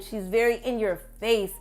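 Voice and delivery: ordinary talk, nothing marked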